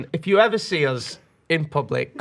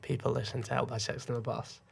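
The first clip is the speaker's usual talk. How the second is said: awed whisper; 4 semitones lower, 11 dB quieter than usual. Retold or imitated. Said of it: "I was like".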